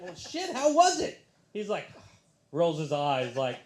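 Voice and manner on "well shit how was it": very high, excited prying; eager questioning. Retold or imitated. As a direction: burst with curiosity, rising high. Eagerly asks friend about it.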